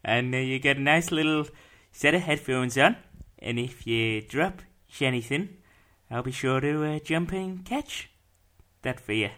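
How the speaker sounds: put-on Dutch accent with rising phrase ends. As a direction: play a friendly Dutchman, lilting up at phrase ends. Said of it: "my Dutch accent".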